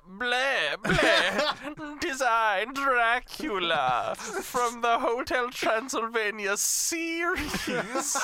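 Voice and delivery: high, slow, hammy vampire voice; Dracula voice. Pretend. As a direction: ham up a count with a laugh. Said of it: "Dracula, after going on his newest vegan diet".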